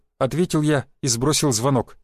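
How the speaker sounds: ordinary delivery, nothing marked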